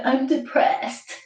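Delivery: lightly mocking gloom; mock moping. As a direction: droop it like a fake sad sack. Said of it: "all of a sudden".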